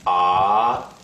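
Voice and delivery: rising "ah"; second tone. Shown as a demonstration. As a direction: lift the pitch through "ah". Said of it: "start your pitch a bit lower and then raise it up".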